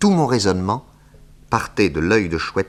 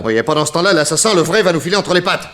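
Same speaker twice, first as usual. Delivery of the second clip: high, loud indignant protest; loud exasperated protest; protesting shout; 11 semitones higher, 6 dB louder, faster than usual. Acted. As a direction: shout your outrage at the arrest. Arrest scene, Nick protests arrest.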